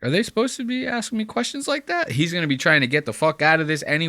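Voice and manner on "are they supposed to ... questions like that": rising indignant complaint, kept soft; indignant Joel. Retold or imitated. Joel complaining to his co-hosts about the producer, softly indignant. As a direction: act affronted, climbing as you go. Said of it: "go like".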